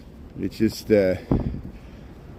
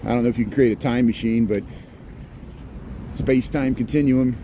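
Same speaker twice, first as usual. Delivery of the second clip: usual speaking voice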